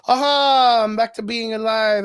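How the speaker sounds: "Aha" popped out high and loud, then eased off; hammed-up stage actor springing back to life; mock actor triumph. Fan retelling a theme-park stunt show, high playful mock actor. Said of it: "And then he's like".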